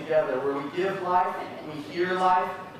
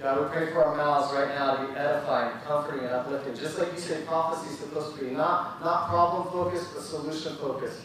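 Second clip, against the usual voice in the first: prayer; 4 semitones lower than usual. Acted. Leads a closing prayer.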